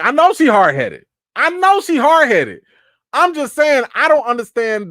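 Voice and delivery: loud, frustrated, exasperated; exasperated outburst. Real, not acted. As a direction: vent it loudly, fed up. Exasperated outburst about character.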